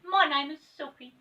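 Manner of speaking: high elf voice; Silky the elf. Acted. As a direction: welcome them in sweetly.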